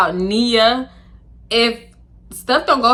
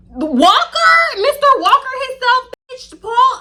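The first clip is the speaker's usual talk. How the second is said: mocking disbelief; 5 dB louder, slower than usual. Real, not acted. Mocking the rapper's name.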